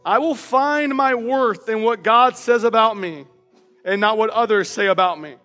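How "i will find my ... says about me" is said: ordinary talk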